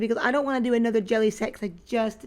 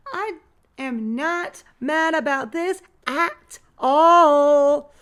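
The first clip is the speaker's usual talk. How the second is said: emphatic approval; 4 semitones higher, 8 dB louder, much slower than usual. Real, not acted. Pleased with the jelly bag.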